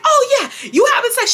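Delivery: high, accusatory mother imitation; hammed-up knowing accusation; accusing mom. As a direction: act out mom piling on. Retold mother scolding in quarrel.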